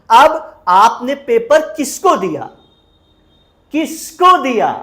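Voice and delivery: किसको punched high and loud, falling afterwards; stress on किसको. Shown as a demonstration. Teacher asking students whom they gave papers to, stressing किसको. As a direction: hit the word किसको hard in the question. Teacher highlights the question word.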